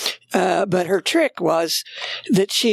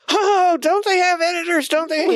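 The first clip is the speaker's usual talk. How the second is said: high-pitched exasperated complaint; hammed-up indignant reader, voice jumping high; mock exasperation; 12 semitones higher than usual. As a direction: protest in disbelief at sloppy work. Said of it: "which drives me crazy".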